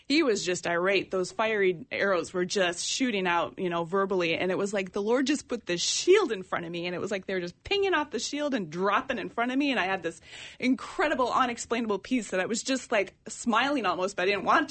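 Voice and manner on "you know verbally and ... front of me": normal talking voice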